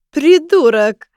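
yelled high and loud, slowly; drunk yell. Acted. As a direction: bellow the insult down the street. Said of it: "заорал спортивный костюм".

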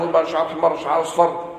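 ordinary talk, nothing marked

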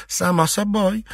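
high-pitched mock agreement; a touch spiteful. A narrator's reading. Mistress jeering at the servant's ignorance, a touch spiteful. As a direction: agree snidely, voice lifted. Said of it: "не без ехидства произнесла".

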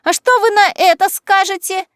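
high, loud screech; shrill squeal. Acted. Daffy about to unmask Kaitlyn, triumphant shrill squeal. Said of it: "взвизгнула Даффи".

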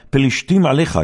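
high, loud urgent warning cry; alarmed call. Acted. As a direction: shout the warning to jolt him awake. Delilah calls out to wake Samson. (קריאת אזהרה רמה ודחופה)

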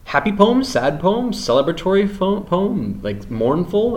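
lilting teacher prompts; rising, coaxing questions; high questioning. Real, not acted. Teacher offers mood options.

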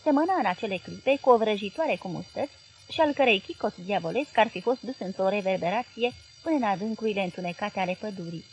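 plain reading voice

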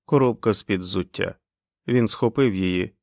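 plain reading voice

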